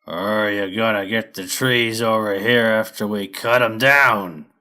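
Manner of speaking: slow, loud growl of a hairy brute; growling lumber boss. Acted. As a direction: drag the words out like a tough crew boss. Lumber boss Fur asks.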